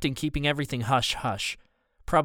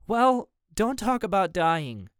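high, slow indignant plea; upset scolding; 7 semitones higher, slower than usual. A narrator's reading. June scolds Dad for talking about dying, upset. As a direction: drag it out, hurt and cross.

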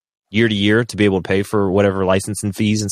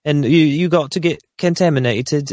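mock Australian accent; 7 semitones higher than usual. Mocking Australian officials on a podcast, in a put-on Aussie accent. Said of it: "the way Australians talk".

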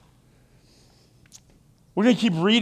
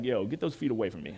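fast, lower, softer brush-off; bored married spouse; 10 semitones lower, 8 dB quieter, faster than usual. Retold or imitated. Husband after marriage refusing a foot rub, gruff brush-off. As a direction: wave off the old romance. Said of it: "After you get married".

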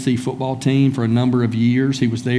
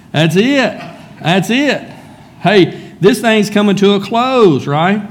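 urgent, higher-pitched rallying calls; mock team rallying; 6 semitones higher, 3 dB louder, slower than usual. Players reminding their teammates in the fourth quarter, mock rallying. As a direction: fire up the team as the game closes.

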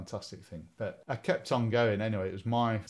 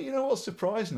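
normal talking voice

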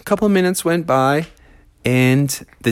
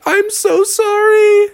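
high sorry; slower than usual. A narrator's reading. Froggy apologizes.

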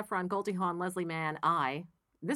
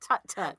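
tsk tsk disapproving; 4 dB louder than usual. Retold or imitated. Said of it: "Ted Knight did it".

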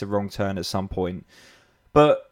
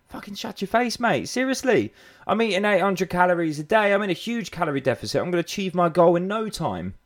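much higher, mocking know-it-all voice; mocking defiant client; 8 semitones higher than usual. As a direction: caricature a client who is sure they're right.

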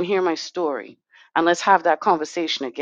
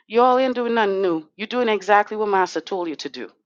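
ordinary delivery

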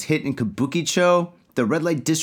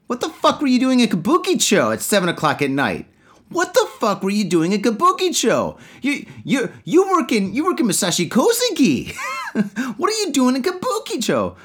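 angry; 9 semitones higher than usual. Pretend. Acted partner scolding him.

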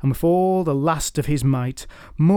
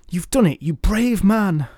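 excited; 6 semitones higher, 3 dB louder than usual. Acted. Marjorie cheers the victor.

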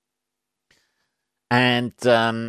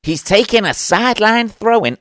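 much higher, louder mock American sports commentator; 10 semitones higher, 5 dB louder than usual. Brit mocking American soccer commentators, American accent. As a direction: hype it like a US soccer announcer. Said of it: "I love watching American reporters".

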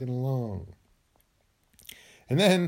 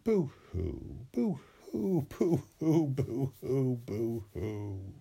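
hammed-up boo-hoo sobbing fading softer; mock crying; 4 semitones lower, 5 dB quieter, much slower than usual. Shown as a demonstration. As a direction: pretend to sob, trailing off. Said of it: "Be sad and say".

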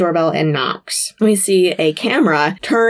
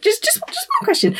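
much higher, squeaky mock plea; hammed-up pleading Dean; 3 dB quieter than usual. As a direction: beg for it in a pitched-up voice. Dean begging Rufus, retold.

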